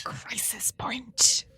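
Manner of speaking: drawn-out whispered echo; slow whisper. Pretend. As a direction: whisper it back very slowly. Echoing the title.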